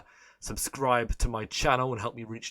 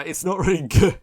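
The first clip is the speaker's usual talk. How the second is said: breaking into a laugh, higher and louder; 6 semitones higher, 8 dB louder than usual. Real, not acted. Streamer admitting his accent is bad, laughing. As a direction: laugh through the words.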